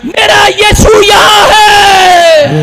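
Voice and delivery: loud, high, drawn-out revival shout; full-force preaching. Acted. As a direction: proclaim it to the congregation, stretching the words. (पूरे ज़ोर से ऊँची आवाज़ में प्रचार)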